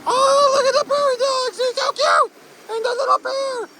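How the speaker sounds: very high, delighted squealing; excited squeal. Real, not acted. Rider spotting cute animals on the ride, high excited squeal. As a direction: squeal over something adorable.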